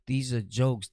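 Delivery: ordinary talk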